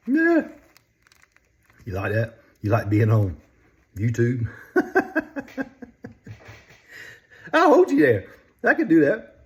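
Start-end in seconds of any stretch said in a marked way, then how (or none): 0.1-0.5 high coo. Pretend. Cooing at toy bunny. 7.5-9.4 put-on high, louder baby talk; high coo to toy. Vlogger cooing to the toy bunny she holds.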